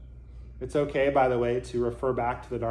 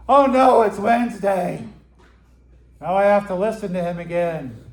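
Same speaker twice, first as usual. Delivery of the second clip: high, loud hammed-up groan of dread; mock dismay; 6 semitones higher, 9 dB louder than usual. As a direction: play the student dreading another talk, loud. Student dreading another Dharma talk.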